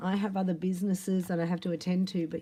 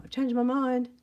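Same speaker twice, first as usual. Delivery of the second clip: higher and louder, suddenly eager; eager reversal; 6 semitones higher, 4 dB louder than usual. Retold or imitated. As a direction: blurt out the change of heart. Quick reversal after refusing.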